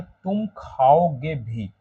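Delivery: "तुम खाओगे भी" slow, weight on खाओगे; teacher drawing it out to lean on खाओगे; stress on खाओगे. Shown as a demonstration. Teacher showing students: stress falls on खाओगे. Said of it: "किस पे जोर देगा? खाओगे".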